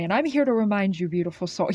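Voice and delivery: ordinary talk, nothing marked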